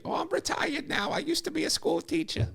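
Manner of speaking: very high, goofy comic falsetto; hammed-up old lady, Adam Sandler style; little old lady. Retired school teacher chatting to TV host, little old lady voice. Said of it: "I don't know why I sound like Adam Sandler".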